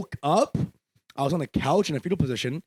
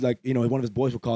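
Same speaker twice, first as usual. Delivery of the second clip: ordinary delivery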